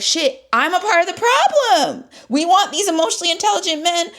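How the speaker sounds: high, exclaimed self-accusation; retold realization. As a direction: exclaim it high, as if it just dawned on you. Retelling sudden realization about herself.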